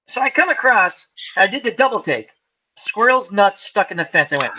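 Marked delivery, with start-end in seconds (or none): none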